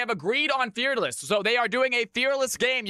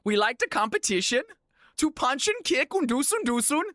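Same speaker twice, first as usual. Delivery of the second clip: put-on higher, playful Finnish accent; hammed-up Finnish accent; mock Finnish accent; 4 semitones higher than usual. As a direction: keep the Finnish accent going for a laugh. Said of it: "don't do that accent".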